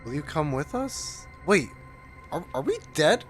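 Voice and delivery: usual speaking voice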